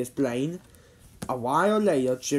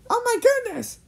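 high, loud shocked exclamation; gasped; 5 dB louder than usual. A narrator's reading. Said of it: "Jimmy from gasped".

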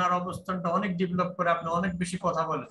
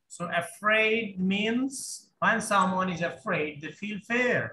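slow model reading; much slower than usual. Shown as a demonstration. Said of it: "slow model reading".

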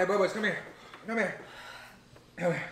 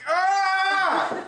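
pained yelp. Real, not acted. Pained by spicy chip.

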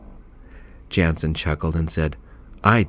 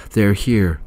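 louder panicked yell; 3 semitones higher, 5 dB louder than usual. Acted. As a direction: yell it out in alarm. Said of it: "Missus Gaines yelled".

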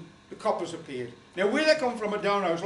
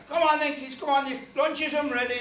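mock calling out; 6 semitones higher, 5 dB louder than usual. Calling kids to lunch.

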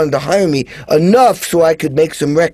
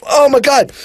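starstruck; 8 semitones higher, 3 dB louder than usual. Retold or imitated. Starstruck reaction.

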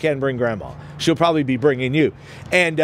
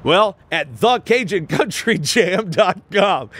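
big radio-ad announcer call-out; 3 semitones higher, slower than usual. Pretend. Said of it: "Do I sound like an announcer?".

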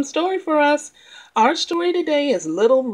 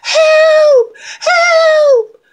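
high, loud panicked scream; screaming for help; 10 dB louder than usual. Acted. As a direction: shriek it out in terror. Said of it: "she screamed".

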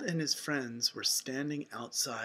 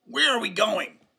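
louder, higher cartoon duck question; Donald; 4 semitones higher, 7 dB louder than usual. A narrator's reading. Duck asking his friend where they go, loud quacky voice. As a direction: ask like a doubtful cartoon duck. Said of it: "Donald moped".

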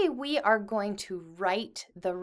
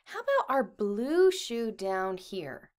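ordinary delivery

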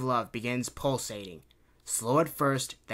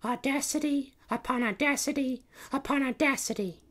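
princess's inner thought; 13 semitones higher than usual. A narrator's reading. Princess Kitty thinking mid-duel, high inner voice. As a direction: chant it inwardly as a cartoon princess. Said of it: "she thought".

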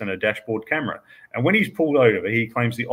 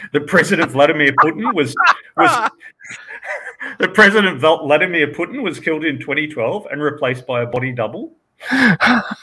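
laughing through; 5 semitones higher, 5 dB louder than usual. Real, not acted. Reading absurd theories while laughing.

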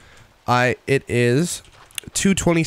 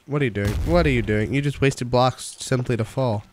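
ordinary talk, nothing marked

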